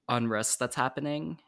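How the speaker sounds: ordinary delivery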